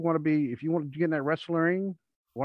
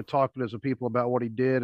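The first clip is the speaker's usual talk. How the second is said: ordinary talk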